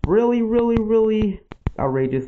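rising alarm at the spreading fire. Real, not acted.

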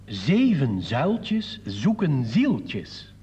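very slow, measured verse delivery; poem recital. Acted. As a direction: stretch out every word of the verse.

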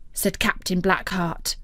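plain reading voice

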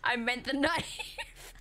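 slow, breaking into a laugh mid-line; giggle-cracked final denial; laughing through it. Real, not acted. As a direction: let the laugh take the last word. Laughing at own innuendo.

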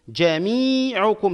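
very slowly stretch "مي" in "جميعكم"; drawn-out long vowel. Shown as a demonstration. Teacher analysing the word for students, drawn-out long vowel. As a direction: hold a high long i in "جميعكم". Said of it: "مد مع الممدود".

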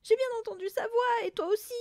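high, frightened yelp; quavering yelp. A narrator's reading. Mother insisting to her husband, quavering yelp. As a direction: yelp it in a panic. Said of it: "glapit la mère".

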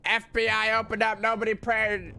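yelling federal agent banging door; FBI raid shout. Pretend. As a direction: bellow like an FBI agent raiding. Mock FBI raid continues.

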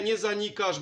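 normal talking voice

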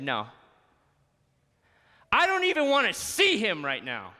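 imagined refusal. Pretend. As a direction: push him away in grief. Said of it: "Imagine if Mary said".

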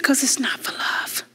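hushed whisper of a sad confession. Retold or imitated. Retelling the trafficked girl's confession in a whisper. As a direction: breathe the words out with no voice. Quoting the trafficked girl, softly.

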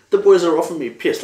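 high, loud and fast, thrilled at the offer; imitating a stoked mate being offered a drink; excited mock quote. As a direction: blurt it out like a buzzing mate. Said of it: "You're like".

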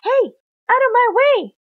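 high, loud warning shout; acted cross shout; indignant yell. A narrator's reading. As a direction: yell to clear the path. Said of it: "Auntie Ant yelled".

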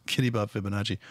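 low, quick mutter through a laugh; mocking laugh. Real, not acted. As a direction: laugh it off under your breath. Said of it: "all giddy about Fibonacci".

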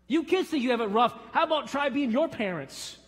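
put-on loud, high challenge to the kids; chiding preaching. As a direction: call the kids out from the pulpit.

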